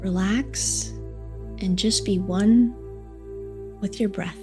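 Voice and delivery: soft, slow meditation voice; guided meditation. Acted. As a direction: guide them gently into calm, unhurried. Leads a heart meditation.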